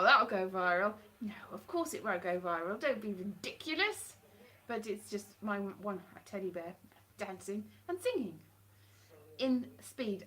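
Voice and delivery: usual speaking voice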